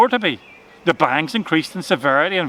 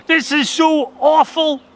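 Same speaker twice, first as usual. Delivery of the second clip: high, slowed rabbit voice in despair; a panicked little rabbit voice; 10 semitones higher, 4 dB louder, slower than usual. A narrator's reading. Rabbit lamenting his ruin to himself, high and despairing.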